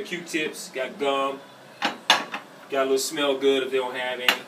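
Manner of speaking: ordinary talk, nothing marked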